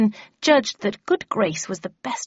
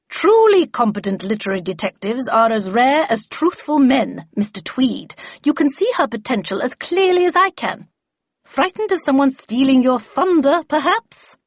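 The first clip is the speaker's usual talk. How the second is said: higher, haughty put-down; Miss Havisham, imperious; 6 semitones higher, 6 dB louder than usual. Acted. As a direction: challenge him with lofty scorn.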